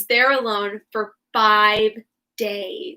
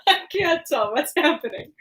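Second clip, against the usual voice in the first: laughing; 4 semitones higher, slower than usual. Real, not acted. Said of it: "laughing at own accent".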